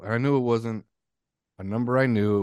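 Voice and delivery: usual speaking voice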